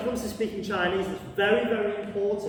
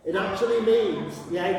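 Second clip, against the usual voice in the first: ordinary talk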